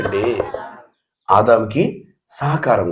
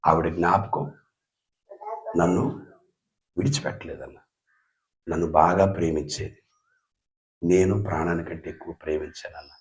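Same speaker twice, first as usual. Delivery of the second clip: grieving, retold; 9 semitones lower, 13 dB quieter than usual. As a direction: let the grief pull the words down, much softer. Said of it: "ఏడుస్తూ ఏమన్నాడు తెలుసా". (చాలా మెల్లగా, దుఃఖంతో)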